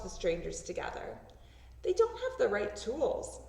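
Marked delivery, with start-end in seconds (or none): none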